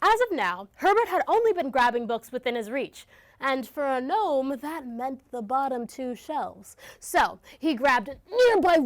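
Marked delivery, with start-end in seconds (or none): none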